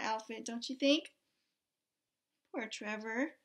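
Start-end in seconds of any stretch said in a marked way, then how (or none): none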